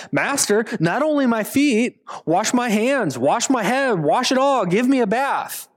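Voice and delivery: hammed-up eager disciple; overeager plea. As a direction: beg to be washed all over.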